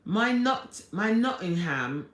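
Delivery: lifted, imitated British accent; attempted British accent. Streamer reading a Nottingham viewer's message, attempted British accent. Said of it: "Are you doing my British accent".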